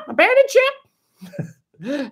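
high, drawn-out parrot squawk. Shown as a demonstration. Streamer trying the parrot voice again for chat, squawking. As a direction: squawk the order slowly like a parrot. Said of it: "How's my parrot voice?".

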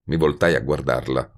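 ordinary delivery, nothing marked

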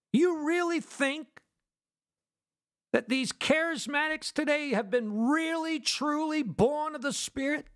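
high-pitched preaching disbelief; incredulous challenge. Real, not acted. Preacher doubting charismatics are born again, incredulous.

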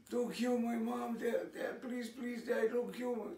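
high-pitched mock begging of children; imitated pleading. Children begging Dad to spare their mom, imitated pleading. Children beg father not to kill.

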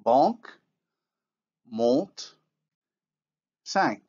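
banque, monte, cinq with nasal vowels, n absorbed; French nasal before consonant. Shown as a demonstration. Teacher reading French example words with nasal vowels. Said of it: "as in the following words".

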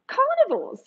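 put-on "carnivores" lifted high and stretched for kids; new word carnivores. Shown as a demonstration. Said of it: "Can you all say that word".

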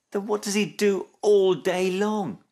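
high, exasperated question; crying out. A narrator's reading. Snip demanding answers from mum, crying out. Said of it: "cried Snip".

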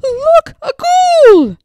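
high, loud scared shriek; frightened cry. A narrator's reading. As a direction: shriek as the ghoul appears. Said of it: "The girls all cried".

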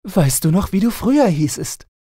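high, teasing princess voice; playful princess. A narrator's reading. Princess teasing her husband about his old name, high and playful. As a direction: tease him sweetly, way up high. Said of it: "wenn sie recht übermütig lustig war".

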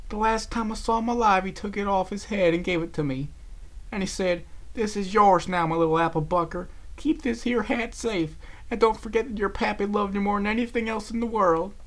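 high-pitched, plaintive recollection; tearful lament. A narrator's reading. Applejack recalling her late pappy's gift, tearfully lamenting. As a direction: pour out a sad memory, pitched high. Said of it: "she sobbed".

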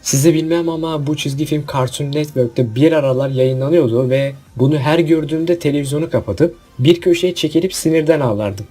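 usual speaking voice

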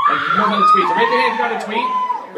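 excited shout to the crowd. Real, not acted. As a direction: shout it out to the room with a laugh. Calling out to the crowd.

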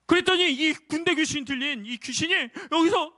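ordinary talk, nothing marked

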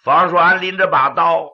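ordinary delivery, nothing marked

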